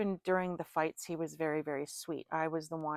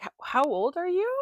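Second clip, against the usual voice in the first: shocked question; 9 semitones higher, 6 dB louder than usual. Retold or imitated. Asking his age in shock.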